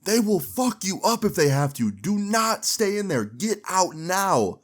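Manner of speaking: high, slowing, pleading shout; retold yelling. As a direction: yell a warning, drawing it out at the end. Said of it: "And I'm yelling".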